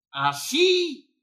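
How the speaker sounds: high loud burst on así; así cried out high and loud; shouted. Real, not acted. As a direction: throw the word out to the congregation. Preacher bursts out mid-prayer.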